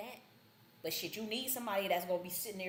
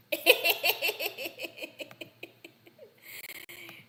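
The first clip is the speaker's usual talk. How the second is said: loud high cackle; 10 semitones higher, 5 dB louder than usual. Real, not acted. Laughing at own joke.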